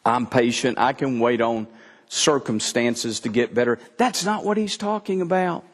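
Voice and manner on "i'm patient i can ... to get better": a lightly voiced smug churchgoer, lower; imitating the congregation. Preacher voicing his congregation, lower and smug. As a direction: voice the complacent listener, low and easy. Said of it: "most of y'all are thinking".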